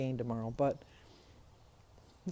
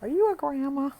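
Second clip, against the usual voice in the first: put-on higher, louder little girl voice; 9 semitones higher, 7 dB louder than usual. Neighbor's little girl talks to her from a toy car, imitated in a high child voice. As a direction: chirp it like a four-year-old girl. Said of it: "And then she said".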